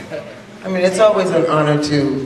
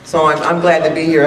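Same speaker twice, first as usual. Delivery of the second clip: ordinary delivery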